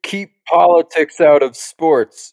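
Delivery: slow, emphatic mock protest; mock demand. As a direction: imitate an indignant complainer. Mimicking complainers about politics.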